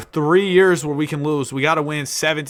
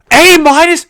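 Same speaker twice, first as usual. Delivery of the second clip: much higher, louder disbelieving yelp; incredulous; 13 semitones higher, 13 dB louder than usual. Real, not acted. Streamer reacts to a prospect's grade, shocked high yelp. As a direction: squeak it out in disbelief.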